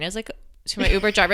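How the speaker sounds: normal talking voice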